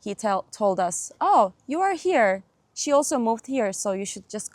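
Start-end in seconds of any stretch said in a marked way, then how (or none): none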